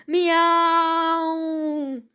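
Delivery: high, long held meow; screaming cat meow. A narrator's reading. Cats terrified of the slimy sea, screamed meow. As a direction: wail like cats in a mild mock scream. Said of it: "screamed the cats".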